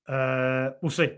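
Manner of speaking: normal talking voice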